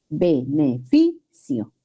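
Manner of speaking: Beneficio said slowly in syllables, with FI punched; stress on FI. Shown as a demonstration. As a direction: break up Beneficio as be-ne-FI-cio, leaning on FI. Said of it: "El acento recae en la penúltima sílaba".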